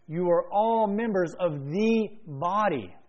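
slow, stretched stress on "all" and "the"; emphatic repetition. Real, not acted. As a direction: drive home "all members of the body", drawing out each key word. Preacher drives home a repeated line.

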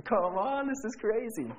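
high-pitched disbelieving protest; retold amazed outburst; incredulous. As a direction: squeal it, can't believe it. Disbelieving reply to Mister Bob.

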